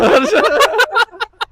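sudden high giggle; high laughter burst. Real, not acted. (yüksek perdeden kahkaha)

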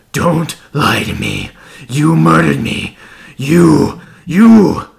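screaming, rising to a shriek; furious scream. A narrator's reading. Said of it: "and he screamed".

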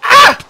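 sudden high yelp of shock; panicked scream. Real, not acted. Player caught by a creeper blast, shrill startled scream. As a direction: let out a sharp frightened scream.

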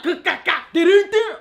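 loud babbling mimicry; exaggerated nonsense stammer and jingle; mocking partner. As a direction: imitate your man as a babbling fool. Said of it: "if your man's like".

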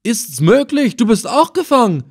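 high-pitched, incredulous exclamation; loud cry. A narrator's reading. Old captive finding the Effendi captured too, loudly amazed. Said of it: "rief er laut".

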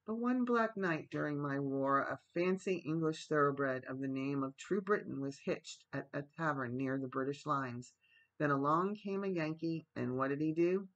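ordinary delivery

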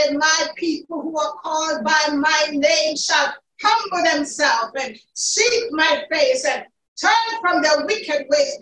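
ordinary talk, nothing marked

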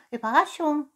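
high-pitched cat echo; whispery cat. Acted. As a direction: echo it high, as if sharing a secret. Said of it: "wisperte die Katze".

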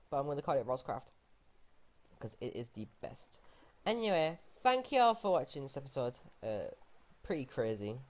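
normal talking voice

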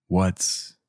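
ordinary delivery

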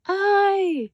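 a slipping cry, lightly done; mild mock yelp. A narrator's reading. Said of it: "screamed Papa".

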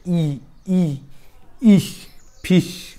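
ई, ई, then इश, then फिश, slowly; sound by sound. Shown as a demonstration. Teacher building the word for students, ई to इश to फिश slowly. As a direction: grow फिश out of a drawn-out ई. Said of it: "आयचा उच्चार कसा होतो".

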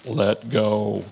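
low, calm voice from above; a lightly voiced God, unhurried and sure. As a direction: speak down from the heavens, simple and final. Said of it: "the voice says".

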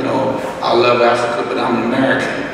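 ordinary delivery

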